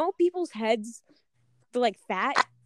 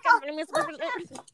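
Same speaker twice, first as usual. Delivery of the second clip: high-pitched mimicry of a comic character's accent; Pee-wee's accent; 7 semitones higher than usual. Quoting friend's breakfast line back to her, in Pee-wee's accent. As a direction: put on his accent, pitched well up. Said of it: "You said it in his accent".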